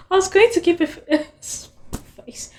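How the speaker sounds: high, fast, about to crack up; can't hold a straight face; breaking into laughter. Real, not acted. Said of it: "I can't really".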